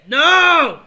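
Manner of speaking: loud, high no; desperate shout. Real, not acted. Gamer shouting as the match turns against him. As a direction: yell it in dismay.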